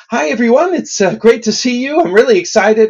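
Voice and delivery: a jittery nervous greeting, high up. Shown as a demonstration. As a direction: voice jumping way up, breathy and excited. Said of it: "The voice goes up and our breath goes up".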